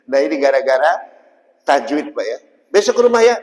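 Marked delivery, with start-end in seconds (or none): 2.7-3.3 cheerful invitation. Retold or imitated. Inviting someone home.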